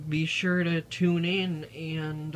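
normal talking voice

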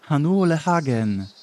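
shouted shield spell. Acted. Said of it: "wykrzyczała zaklęcie tarczy".

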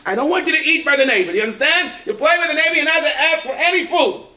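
high, loud, hammed-up scolding mother. Preacher imitating a mother warning her kid, mock scolding. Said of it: "the mother says".

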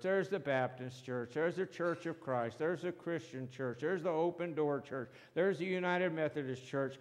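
ordinary talk

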